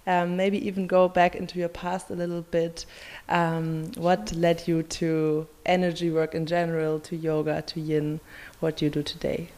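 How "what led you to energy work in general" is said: ordinary talk, nothing marked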